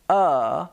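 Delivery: "uh" as a simple neutral vowel; a relaxed, drawn-out "uh"; plain schwa "uh", demonstrated alone; schwa sound. Shown as a demonstration. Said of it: "It's just a simple".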